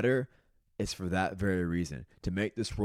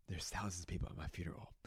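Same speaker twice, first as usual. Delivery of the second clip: hushed; 3 semitones lower, 15 dB quieter, faster than usual. Real, not acted. Imagining his own funeral.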